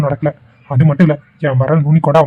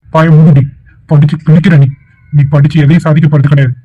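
loud furious scolding of his sister; angry shout; 3 dB louder than usual. Acted. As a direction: bark at her to shut up. (தங்கையை கோபமாக திட்டும் அண்ணன், உரத்த குரலில்)

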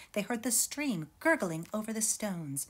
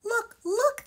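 high, loud excited call; excited cry; 8 dB louder than usual. A narrator's reading. Excited cubs calling out to their uncle, thrilled. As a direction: cubs shouting in delight. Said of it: "they cried".

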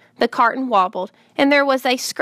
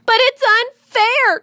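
high, loud, drawn-out protest; slow, high-pitched indignant cry; 12 semitones higher, 5 dB louder, slower than usual. A narrator's reading. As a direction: wail it like an outraged child. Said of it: "cried Fern".

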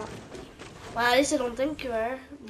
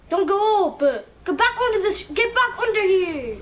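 high, loud, frantic calling; shouting; 8 semitones higher, 6 dB louder than usual. Real, not acted. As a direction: yell at him to come back. Teammate runs into danger.

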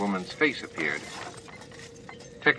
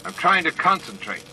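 higher, irritated complaint; cross; 9 semitones higher than usual. A narrator's reading. O'Brien complaining from the station, crossly. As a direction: bite it off, annoyed at the noise. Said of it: "a cross voice called".

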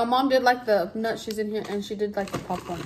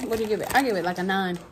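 talking while chewing; eating a wafer snack; mouth partly full. Real, not acted. Seen 1: left speaker lifts wafer bits to mouth, talks with food.

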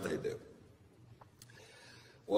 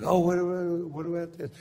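mock high whiny fan voice; pestering fan; 11 semitones higher than usual. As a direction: imitate a nagging fan, pitched up. Fans pestering Doc in public.

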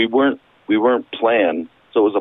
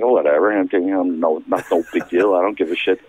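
low, unbothered retold shrug-off; couldn't-care-less, pitched low; dismissive; 6 semitones lower than usual. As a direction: brush it off in a low voice. Retells shrugging off the sand.

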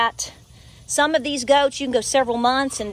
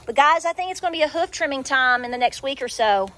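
usual speaking voice

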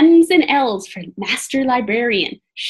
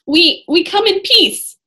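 stammering; 7 semitones higher, 5 dB louder than usual. Acted. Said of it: "stammered Jack".

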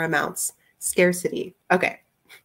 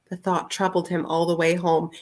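usual speaking voice

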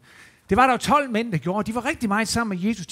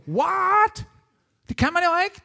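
astonished; 10 semitones higher, 4 dB louder, slower than usual. Acted. Astonished men in the boat.

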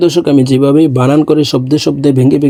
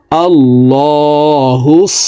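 slow, drawn-out melodic tilawat; Quranic recitation; much slower than usual. Acted. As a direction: intone it slowly like a reciter. Recites blended word. (ধীর সুরেলা তিলাওয়াত)

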